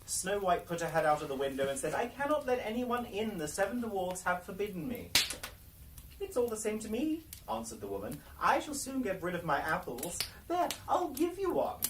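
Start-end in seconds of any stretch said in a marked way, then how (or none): 1.9-5.1 put-on higher, girlish refusal. 6.2-7.3 put-on higher, sly old woman's voice; old peasant woman. The disguised Queen at the dwarves' door, sly old woman. 8.4-11.8 put-on higher, sly old woman's voice; old peasant woman. The disguised Queen at the dwarves' door, sly old woman.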